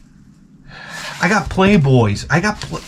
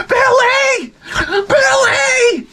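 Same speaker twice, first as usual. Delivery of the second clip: mock calling out; much slower than usual. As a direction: yell his name like an angry mom outside. Neighbor mom calling at door.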